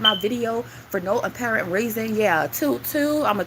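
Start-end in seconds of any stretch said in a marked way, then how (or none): 2.5-3.2 slow high toot toot. Pretend. Repeats the whistle call, drawn out.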